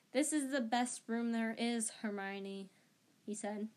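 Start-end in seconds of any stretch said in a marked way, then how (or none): none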